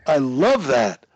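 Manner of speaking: loud, drawn-out delight with swooping pitch; delighted approval. Real, not acted. Coach cheering a student's affirmation, delighted. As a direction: cheer it on loudly and slowly.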